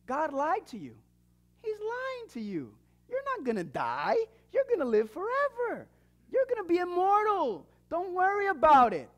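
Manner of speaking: a hint of a sly serpent whispering lies; mock devil voice. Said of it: "The devil, what is he saying?".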